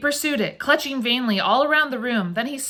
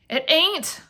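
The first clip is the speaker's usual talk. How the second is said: frightened; 8 semitones higher than usual. Acted. Frightened wife denies.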